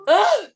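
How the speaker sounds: high, loud groan of dismay at the bill; disgusted groan. Retold or imitated. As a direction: let out a pained ugh over the cost. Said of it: "I'm like".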